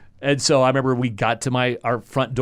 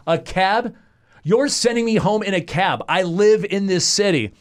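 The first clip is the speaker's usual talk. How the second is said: higher and indignant, slowing on the last line; incredulous, pitched-up protest; indignant disbelief; 7 semitones higher than usual. Retold or imitated. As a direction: retell your outrage at the cab. Retold outrage at being sent home.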